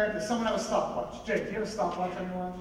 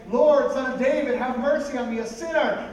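calling out; 6 semitones higher, 9 dB louder than usual. Acted. Blind men calling out to Jesus.

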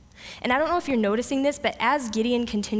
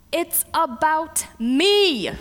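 slow, loud and higher, stretching each word; emphatic declaration; 7 semitones higher, 5 dB louder, much slower than usual. Acted. God telling Gideon whose glory it is, emphatic declaration. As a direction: proclaim it as God, drawn right out.

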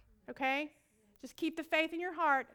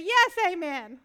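agreeing amen; 4 semitones higher, 10 dB louder, slower than usual. Retold or imitated. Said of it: "And she'll say".

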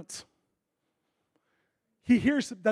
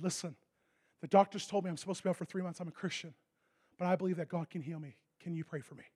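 much softer, earnest; quiet plea; 3 semitones lower, 8 dB quieter than usual. Retold or imitated. Injured hockey player quietly asking believers for prayer, humble. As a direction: ask humbly in a hush.

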